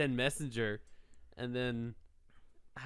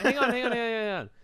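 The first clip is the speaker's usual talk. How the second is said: high, louder, urgent hang on; 1 urgent interruption; 7 semitones higher, 5 dB louder than usual. Real, not acted. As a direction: cut in fast to stop the accusations. Cutting in to defend himself.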